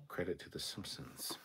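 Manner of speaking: ordinary delivery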